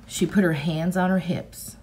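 plain reading voice

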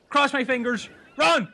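ordinary talk

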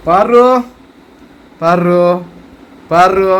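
loud, short name calls. Real, not acted. Father calling the baby's pet name, calling out.

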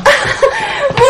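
high burst of joyful laughter; a delighted giggle; happy laugh. Acted. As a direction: laugh with relief and glee. Girl delighted aunt agrees.

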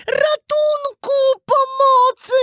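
very high, drawn-out puppet wail; terrified cry for help. A narrator's reading. Puppet swallowed into the fish's darkness, terrified. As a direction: cry out for rescue, piping and stretched. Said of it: "wołał w ciemności przerażony pajacyk".

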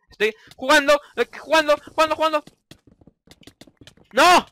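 high, loud escalating repeats; rising frustrated yell. Real, not acted. Gamer repeating himself while getting killed, loud rising yell. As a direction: lose it as you die mid-sentence.

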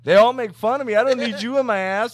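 loud and high complaint; heated rant. Pretend. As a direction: vent at a coworker loudly. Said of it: "Bro, I".

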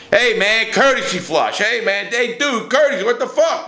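mock inmate yell. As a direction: bark it up high like a fed-up cellie. Cellmates yelling about courtesy flush.

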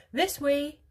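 high, loud call; crying out. A narrator's reading. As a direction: call the group onward. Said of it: "cried Mister Wonka".

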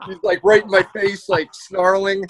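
normal talking voice

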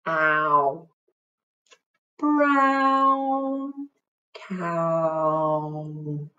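long drawn-out mooing cow. Acted. Teacher shows how a cow would speak, drawn-out cow voice. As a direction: stretch the words out like a cow. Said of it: "That's how I imagine a cow would speak".

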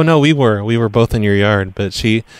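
normal talking voice

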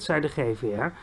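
plain reading voice

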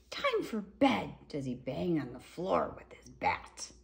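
lively, sing-song picture-book reading; reading to children. A narrator's reading. Reading a dinosaur book to children, lively storybook voice. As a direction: read it out like a bedtime story.

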